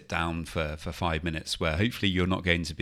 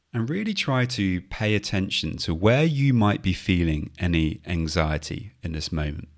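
guided meditation. Acted. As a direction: gently talk them through the breath. Leads a mindfulness body scan.

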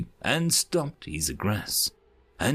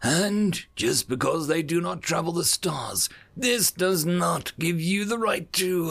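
shouted accusation; 7 semitones higher than usual. Acted. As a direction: hurl the charge across the chamber. Ambassador denouncing envoy.

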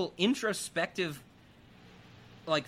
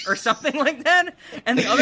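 louder, higher, amused retelling; laughing; 8 semitones higher, 9 dB louder, faster than usual. Real, not acted. Host recalls firefighter not fighting the fire, laughing. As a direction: laugh through the description. Cracking up describing the montage.

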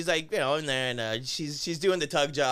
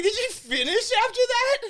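high, louder incredulous question; mock incredulous; 5 dB louder than usual. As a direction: squeak it out in disbelief. Incredulous question in retold story.

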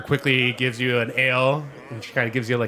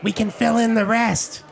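high, chirpy innkeeper gnome; perky little host pushing drinks, pitched way up; Gilly the gnome; 14 semitones higher than usual. Acted. Gnome innkeeper offering ales, high and chirpy.

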